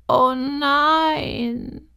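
the tiny horse groans out its dismay. A narrator's reading. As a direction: fuller high oh nein falling down. Unseen horse repeats in dismay.

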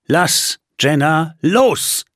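slow, measured command; calm, deliberate order. Acted. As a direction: say it slowly, keeping the spell steady. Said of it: "sagte er bedächtig".

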